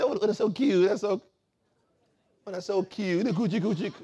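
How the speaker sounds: put-on high-pitched cooing over a baby; baby talk. Adults fussing over a spoiled child, high baby-talk cooing. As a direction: coo like a doting adult at an infant.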